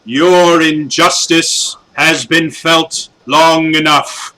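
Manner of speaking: a doomed guardian declaring his last stand; solemn hero's defiance. Acted. Zordwin defies Bandora before sacrificing himself, slow grave loud proclamation. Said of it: "And he goes".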